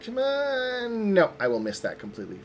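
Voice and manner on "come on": high, drawn-out plea; real high-pitched urging; pleading with dice. Real, not acted. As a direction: stretch it out high, willing the roll. Pleading with the dice as they roll.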